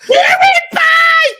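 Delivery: long, high, loud hype shout. Real, not acted. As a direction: yell it out like a crowd at a fight. Hypes the fight again.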